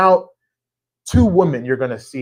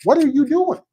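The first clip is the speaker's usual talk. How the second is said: exasperated editor; 13 semitones higher, 4 dB louder than usual. Retold or imitated. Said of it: "And he's like".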